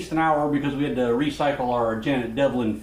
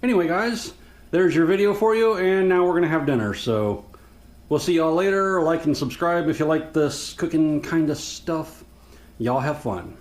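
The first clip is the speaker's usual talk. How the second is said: outro sign-off; 4 semitones higher than usual. Acted. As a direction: wrap it up for the viewers. Sign-off to viewers.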